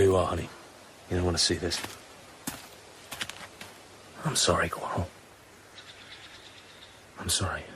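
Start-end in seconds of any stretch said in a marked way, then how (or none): 4.2-7.6 much softer, low apology; quiet grief. Acted. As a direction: murmur it over a dead friend. James grieving over Quarrel's body.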